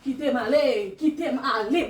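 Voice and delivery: loud desperate plea; pleading shout. Acted. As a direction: beg to be let go. Acting possessed, struggling.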